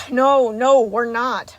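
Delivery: loud, angry cut-in; forceful, fed-up denial; yelled contradiction. A narrator's reading. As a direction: yell them down. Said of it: "Monty yelled".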